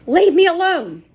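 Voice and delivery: loud, high scream of protest; shout. A narrator's reading. Sarah restrained by a Spanish man, shouting. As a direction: yell at the man holding her. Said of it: "Sarah suddenly shouted".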